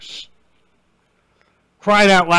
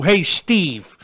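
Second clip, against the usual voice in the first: put-on kid voice. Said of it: "We don't call you".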